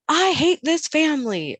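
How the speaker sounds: high, loud mock tantrum; retold child's scream. Mom quoting her angry son, mock screaming. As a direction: yell it like an outraged kid. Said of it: "at one point he screamed".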